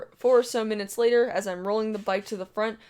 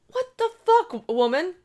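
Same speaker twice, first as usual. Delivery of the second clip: much higher, louder shocked yell; outraged outburst; 10 semitones higher, 5 dB louder than usual. Retold or imitated. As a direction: burst out in disbelief.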